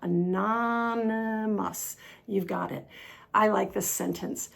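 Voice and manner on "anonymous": "anonymous" very slowly, lingering on N and M; N and M together. Shown as a demonstration. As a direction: stretch "anonymous", joining the N to the M. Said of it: "We've got the N and the M going together".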